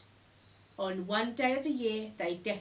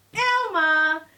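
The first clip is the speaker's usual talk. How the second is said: loud, high call of the name; shouted recognition; 7 semitones higher, 14 dB louder than usual. A narrator's reading. Startled herd cries out on recognising their friend. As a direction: realise who it is and cry it out.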